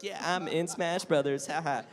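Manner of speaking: playful little gloat with a laugh; gleeful. Retold or imitated. Actor joking about jigging in the corner, gleeful. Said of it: "doing the Irish jig".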